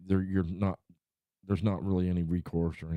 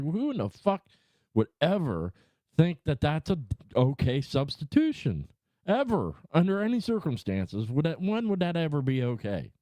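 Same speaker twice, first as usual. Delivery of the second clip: raised, higher indignant complaint; indignant rant; 8 semitones higher than usual. Retold or imitated. Complaining about the store substitution, higher indignant rant. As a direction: rail at the store's substitution, pitched up. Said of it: "I'm like".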